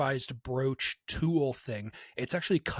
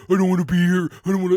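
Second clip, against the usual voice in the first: much louder whining, put-on voice; mock whiny complaint; 4 semitones higher, 13 dB louder, much faster than usual. Friend mocking teammate who won't move, loud whine. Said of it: "mocking a reluctant teammate".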